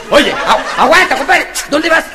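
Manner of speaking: loud, high stop call; loud high bouncer challenge; bouncer's challenge. Acted. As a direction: halt the intruder loudly. Bouncer stopping the horse.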